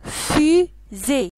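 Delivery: FUSÉE in two loud beats, fu, zée; syllable by syllable. Shown as a demonstration. Teacher names the picture fusée, loudly split into syllables. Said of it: "Il y a deux syllabes".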